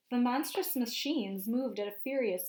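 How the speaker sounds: lively storybook narration for a class; picture book read-aloud voice; reading to children. A narrator's reading. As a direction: read it out to the kids, painting the scene.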